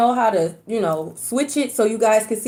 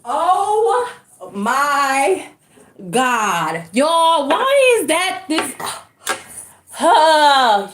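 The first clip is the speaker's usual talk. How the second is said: shocked; 3 semitones higher, much slower than usual. Real, not acted. Hears music outside, shocked.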